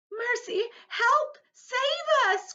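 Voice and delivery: high, loud cries for help; frantic cry. A narrator's reading. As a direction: shriek for rescue in panic. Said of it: "cried the ladybug".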